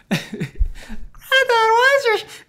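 piercingly high mock kid voice; annoying kid. Grandson asks granddad about his shirt, in an annoying high kid voice. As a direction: whine it way up high like an irritating child. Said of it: "in the annoyingest voice you could do".

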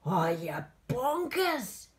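loud, high-swooping monster outrage; horrible howl. A narrator's reading. Monster Nettie corrects George, loud indignant howl. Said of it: "with a horrible howl".

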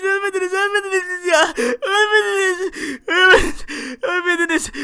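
high, loud, tearful insisting; grieving father pleading over his dead family; crying denial. Acted. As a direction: sob it out, refusing to believe.

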